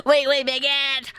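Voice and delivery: cartoonish mock French accent. Reader clowning as the French lover, mock French accent. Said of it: "how to do it in French accent".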